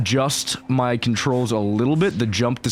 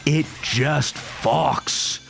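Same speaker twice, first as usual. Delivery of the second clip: drawn-out emphatic praise; much slower than usual. Shown as a demonstration. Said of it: "same words said twice".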